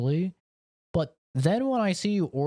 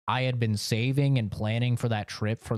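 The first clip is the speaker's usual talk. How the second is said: usual speaking voice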